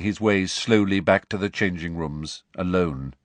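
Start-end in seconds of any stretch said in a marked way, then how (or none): none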